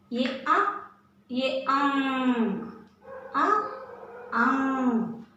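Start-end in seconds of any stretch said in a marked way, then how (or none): 1.3-2.6 अंग held long through the nose; teacher contrasting अंग with आ; slow nasal demo. Shown as a demonstration. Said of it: "इसका उच्चारण हम नाक से करते हैं".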